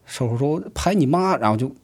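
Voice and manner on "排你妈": high-pitched acted curse; imitating a heated comeback; retold angry retort. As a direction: throw the insult back, mock-angry. Acted quarrel, retort in line dispute.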